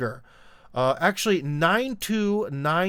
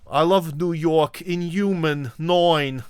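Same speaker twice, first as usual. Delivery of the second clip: put-on New Yorker accent; 3 semitones higher, 4 dB louder, slower than usual. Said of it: "the sort of classic, uh, uh, New Yorker pronunciation there".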